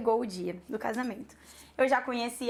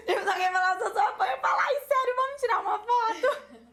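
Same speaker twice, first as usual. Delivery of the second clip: higher, giggling through the words; laughing while talking; 10 semitones higher, faster than usual. Real, not acted. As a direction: talk through real laughter at yourself. Laughing at her own eagerness.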